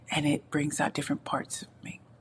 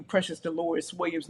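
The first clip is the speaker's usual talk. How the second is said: usual speaking voice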